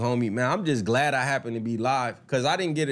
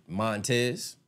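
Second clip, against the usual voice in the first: usual speaking voice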